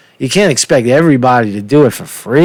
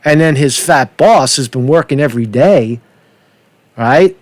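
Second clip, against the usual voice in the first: ordinary delivery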